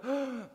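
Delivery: high loud crowd oh; loud mock crowd oh; crowd groan. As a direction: voice the whole stadium groaning. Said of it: "the whole crowd go".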